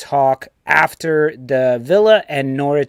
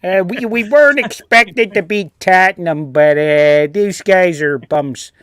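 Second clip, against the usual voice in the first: higher, mock Chicago-New York accent; Chicago accent slipping into New York; 3 semitones higher, 3 dB louder than usual. Fan dismissing the team as bums, Chicago-New York accent. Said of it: "mixed in some New York there".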